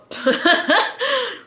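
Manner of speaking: high burst of giggles; real laughter. Real, not acted. Cracks up while signing off.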